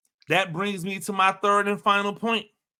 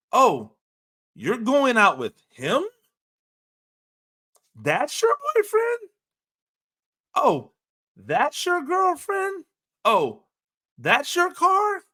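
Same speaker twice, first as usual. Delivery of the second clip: mock disbelief; 8 semitones higher than usual. Scene: teasing a friend about their partner and car, high mock disbelief. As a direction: voice jumps way up in fake incredulous questions. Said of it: "That's like me saying".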